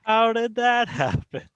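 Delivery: mock innocent. Feigned innocence after disconnect.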